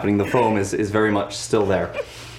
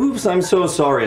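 fake apology; 8 semitones higher, 4 dB louder than usual. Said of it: "pop and go".

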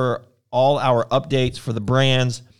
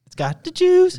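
excited; 4 dB louder than usual. Acted. Hyping the pun.

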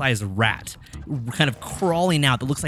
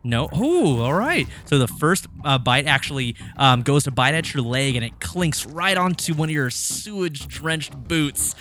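ordinary delivery

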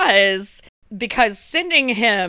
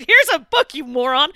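higher, louder mock snap at a husband; hammed-up angry jab; mock angry wife; 4 semitones higher, 4 dB louder than usual. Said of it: "She's fucking".

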